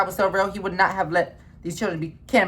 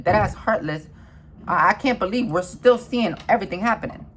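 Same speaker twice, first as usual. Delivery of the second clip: normal talking voice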